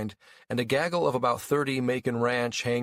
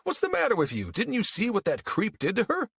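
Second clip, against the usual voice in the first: indignant; 12 semitones higher than usual. Acted. Jim angry at sheriff.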